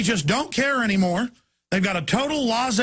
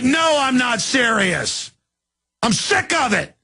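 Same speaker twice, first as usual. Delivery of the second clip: high, drawn-out indignant refusal; retold fed-up retort; 4 semitones higher, 3 dB louder, slower than usual. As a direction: re-enact standing up to a cop. Acted quarrel with a cop.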